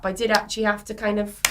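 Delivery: ordinary talk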